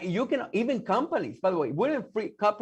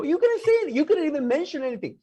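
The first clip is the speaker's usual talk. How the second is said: emphatic high outburst; 10 semitones higher, 4 dB louder than usual. Real, not acted. Man telling listener about Cuba, an emphatic high outburst. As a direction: voice jumps way up, louder, on You couldn't say it.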